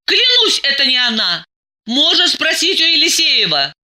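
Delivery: high-pitched, urgent oath; insistent pleading. A narrator's reading. Diana swears it is not her, pleading insistently.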